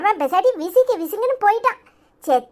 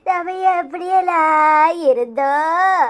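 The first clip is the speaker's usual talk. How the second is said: mock funeral lament; 2 semitones lower than usual. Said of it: "இப்படி ஒப்பாரி வச்சுக்கிட்டு இருக்க".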